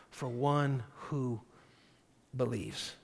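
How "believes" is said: normal talking voice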